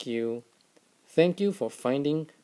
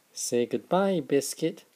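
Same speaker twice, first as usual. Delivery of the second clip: ordinary delivery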